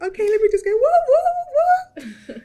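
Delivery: high, loud re-enacted whoops; squealing celebratory woos; whooping cheer. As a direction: whoop it out at full pitch. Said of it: "I was losing my voice".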